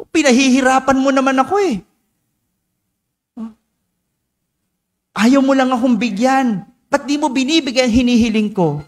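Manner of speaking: half-hearted protest at the father who holds back; mock sulking complaint. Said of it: "Pwedeng ganun ang reaksyon. Pwedeng magtampo". (parang nagtatampong anak, mabilis at medyo mataas ang boses)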